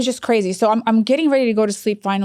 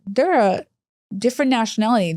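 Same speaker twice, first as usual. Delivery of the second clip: usual speaking voice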